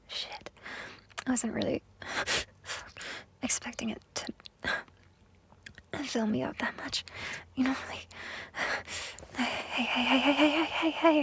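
usual speaking voice

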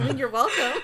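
laughing mock reply. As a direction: say it through a laugh.

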